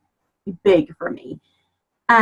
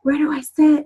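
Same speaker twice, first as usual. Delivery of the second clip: shy newcomer; 7 semitones higher than usual. Retold or imitated. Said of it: "and I said".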